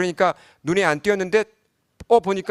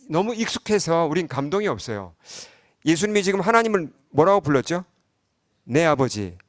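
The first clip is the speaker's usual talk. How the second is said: ordinary delivery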